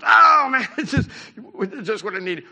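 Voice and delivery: a frustrated driver blowing up at a flat tire; exasperated outburst. Retold or imitated. Driver with a flat tire on the way, exasperated outburst. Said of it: "you can react in several ways, you know. You go".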